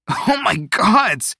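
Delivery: slow, high choked outcry; spluttering. A narrator's reading. Teo with bubbly water up his nose, spluttering. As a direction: splutter it out in high-pitched disgust. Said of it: "Teo spluttered".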